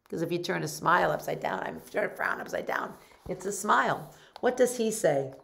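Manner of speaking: ordinary delivery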